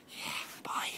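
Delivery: soft breathy whisper bye; hushed farewell to viewers; whispered goodbye. Real, not acted. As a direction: whisper the sign-off. Whispered sign-off.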